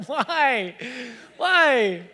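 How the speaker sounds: loud, high, laughing disbelief; exasperated laughing shout. Retold or imitated. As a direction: throw up your hands and laugh-yell it. Son ripped off the glue.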